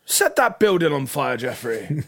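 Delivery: God's loud, high order to an assistant; acted command. God orders Jeffrey to burn a building, commanding. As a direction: bark an order, playing God.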